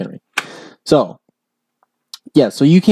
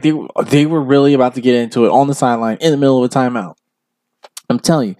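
usual speaking voice

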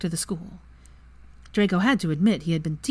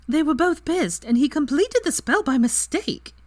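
amused outburst; 10 semitones higher, 4 dB louder than usual. A narrator's reading. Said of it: "Ginny burst into laughter".

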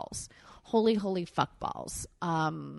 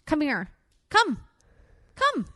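much higher, rising sing-song call; calling the dog; 9 semitones higher, 5 dB louder than usual. Real, not acted. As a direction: coax the dog over with a bright call.

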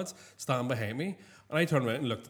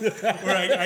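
normal talking voice

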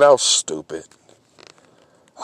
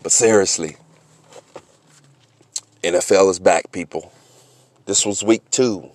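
lower, softer, calm outro read; laid-back show closer; podcast host sign-off; 11 semitones lower, 8 dB quieter than usual. Acted. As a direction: wind the episode down for listeners.

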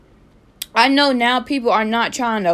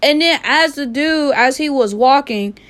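usual speaking voice

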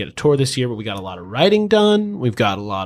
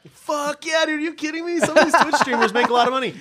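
excited; 12 semitones higher than usual. Real, not acted. Hyped about Twitch money.

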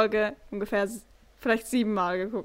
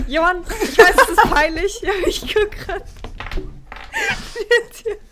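laughing; 9 semitones higher, 7 dB louder than usual. Retold or imitated. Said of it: "ich war so".

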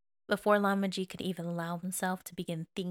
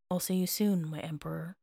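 soft, tender, fading low; soft smile; 3 semitones lower than usual. Acted. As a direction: say goodbye with a smile in the voice. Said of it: "said with a soft smile".

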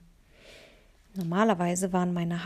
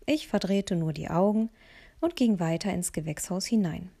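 ordinary delivery, nothing marked

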